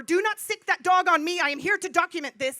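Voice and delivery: loud, emphatic protest; forceful retold command. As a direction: call it out firmly to stop an officer. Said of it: "first words out of my mouth were".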